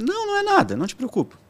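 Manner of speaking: high voiced mom insisting it's nothing; imitating mom. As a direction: play the mother brushing it off. Mother brushes off worry.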